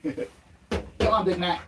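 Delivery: high, soft sing-song to the dog; doggy talk. Real, not acted. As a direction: coo gently at the running dog. Coos to the dog running.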